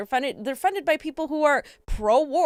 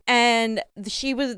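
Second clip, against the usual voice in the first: usual speaking voice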